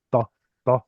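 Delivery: "to" with a heavy T from the back; guttural emphatic T. Shown as a demonstration. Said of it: "a guttural kind of emphatic".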